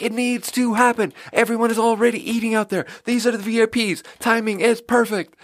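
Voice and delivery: frantic urging. Retold or imitated. Waiter pressing the chef about the VIP table, high and frantic. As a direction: plead urgently for speed.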